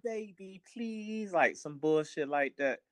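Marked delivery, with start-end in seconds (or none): 0.0-1.3 mock pleading, higher and softer; put-on soft, pleading baby talk; wheedling baby voice. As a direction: imitate someone softening their tone to get their way. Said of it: "soften up their tone to get".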